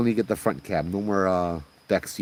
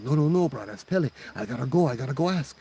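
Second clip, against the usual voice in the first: high-pitched, insistent argument; urgent insisting; 5 semitones higher than usual. Acted. Driver telling scared friend he must stop for Pele, urgent. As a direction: push back urgently in a raised pitch.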